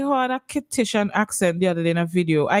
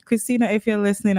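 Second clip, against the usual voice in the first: normal talking voice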